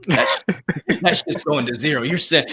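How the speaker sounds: mock taunt that breaks into laughter; can't finish the taunt for laughing; laughing through it. Cracking up mid mock taunt.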